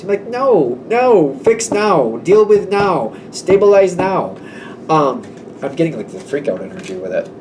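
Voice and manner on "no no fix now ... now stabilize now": high, slow, emphatic demands; insistent. Retold or imitated. Worried friend to someone putting it off, urgently insistent. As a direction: order them firmly, word by word.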